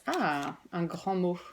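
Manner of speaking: usual speaking voice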